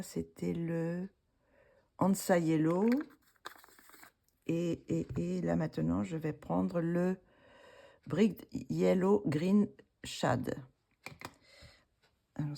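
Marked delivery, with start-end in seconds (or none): 2.0-2.9 French-accented English. Real, not acted. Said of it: "avec mon accent". 8.1-10.6 French-accented English. Real, not acted. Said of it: "avec mon accent".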